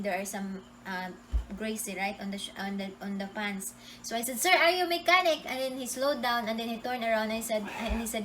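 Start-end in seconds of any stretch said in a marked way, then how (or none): 4.4-5.4 high, loud hailing call; retold shout. Calling to the man on the motorcycle, retold shout. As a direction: re-enact calling after a passer-by. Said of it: "So I shouted him in the motorcycle".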